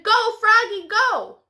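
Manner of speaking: high, loud cheer; a friend cheering the rider on; cheering shout. Acted. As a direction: cheer him on from the side. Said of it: "Froggy's friend shouted".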